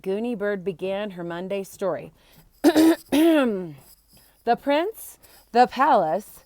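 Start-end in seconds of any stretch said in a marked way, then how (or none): 2.6-3.7 throat clear. Acted. Storyteller readies to begin.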